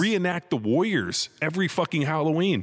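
high-pitched, mock-outraged rant; retold upset rant. Homeowner ranting at timid trick-or-treaters, mock upset. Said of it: "I was so fucking upset".

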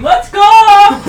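high, loud, stretched stadium holler; re-enacted yell. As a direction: belt it out slowly like a rowdy fan. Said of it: "I just started yelling whole game".

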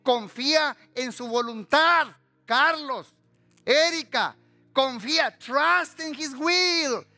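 high, forceful preacher with drawn-out words; impassioned preaching. Acted. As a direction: exhort the congregation, stretching key words. (predicación apasionada, aguda y con palabras alargadas)

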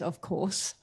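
low, soft, self-satisfied voice; smug Haman. Retold or imitated. Haman answers the king, low and smug. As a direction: play the arrogant noble.

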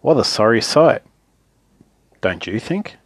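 usual speaking voice

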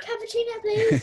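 high, loud cappuccino order; loud request. Real, not acted. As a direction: shout the order eagerly. Child shouting coffee order.

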